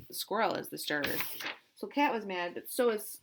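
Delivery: ordinary delivery, nothing marked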